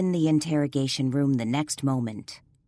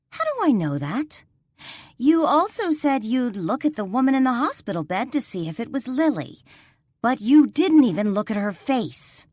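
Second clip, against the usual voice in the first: heated reproach; 7 semitones higher, 4 dB louder than usual. Acted. Arguing with the sergeant.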